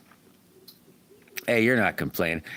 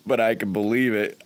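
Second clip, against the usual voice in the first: ordinary talk, nothing marked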